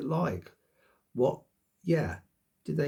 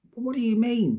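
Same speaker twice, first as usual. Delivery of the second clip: higher and louder, exasperated; aggressive retort; 10 semitones higher, 8 dB louder than usual. Retold or imitated. Imagining snapping at the doctor, aggressive and loud. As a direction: fire the question back, irritated and loud. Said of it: "getting really aggressive".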